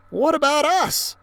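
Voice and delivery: put-on high, anxious girl; worried kid voice. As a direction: wonder aloud, worried. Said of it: "I wondered".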